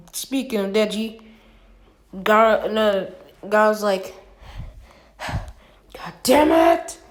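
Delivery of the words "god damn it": high, loud, slow furious cry; angry outburst. Acted. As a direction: stretch it out in frustrated rage. Gaara's angry outburst in fight.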